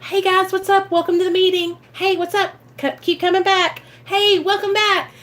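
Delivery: high, cheery welcome; cheery door greeter. Pretend. Door greeter cheerily welcoming people at the meeting. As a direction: greet everyone bright and friendly. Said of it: "You can be the door greeter".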